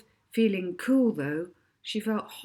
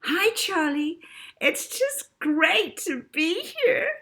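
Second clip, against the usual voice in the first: put-on much higher, slow, sugary child star voice; sugar-sweet girl; 10 semitones higher, 4 dB louder, slower than usual. A narrator's reading. Child star Davina sweetly greeting the TV host. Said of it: "came the sugar-sweet voice of Davina".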